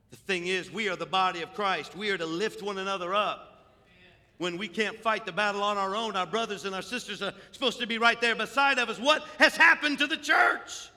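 loud preaching building to a high shout; full-force preaching. Real, not acted. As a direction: build steadily into an impassioned cry.

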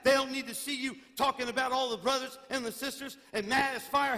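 loud, high, driving pulpit voice; fiery revival preaching; preaching at full force. Acted. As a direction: preach it out and press the point on the congregation.

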